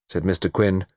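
usual speaking voice

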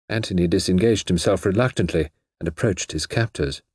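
ordinary delivery, nothing marked

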